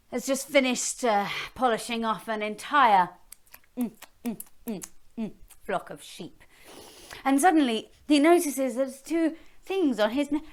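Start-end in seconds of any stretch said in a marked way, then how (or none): none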